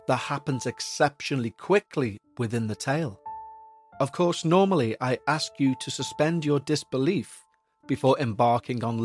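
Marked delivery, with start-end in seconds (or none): none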